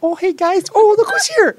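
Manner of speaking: very high, loud cooing voice; baby talk. Retold or imitated. Dad greeting buddies in his baby-talk voice, very high and loud. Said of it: "I'd be like".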